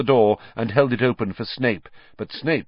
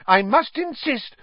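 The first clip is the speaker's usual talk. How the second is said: very high, hysterical insistence. A narrator's reading. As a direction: insist frantically at the top of your range. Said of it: "said Madam Pumphrey hysterically".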